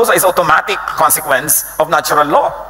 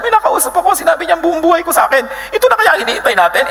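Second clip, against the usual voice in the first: much higher, fast and breathless with a laugh; excited village woman; faster than usual. Acted. Woman at the well telling the townspeople, much higher and fast with a laugh. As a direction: burst out with the news like you can't believe it. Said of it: "sinabi niya sa mga taong bayan".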